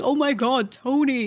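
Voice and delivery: playfully gushing recognition; excited fans imitated. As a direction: imitate people thrilled to spot someone famous. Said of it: "everyone who sees him is like".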